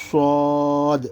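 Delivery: صاد with a rounded heavy s, vowel held long; soft whistling صاد. Shown as a demonstration. Teacher demonstrating the letter, صاد rounded and drawn out. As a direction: whistle softly into a stretched صاد. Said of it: "سی ٹی ہی کی آواز ہے لیکن نرم".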